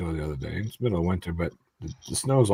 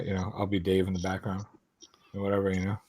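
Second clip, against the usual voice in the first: ordinary delivery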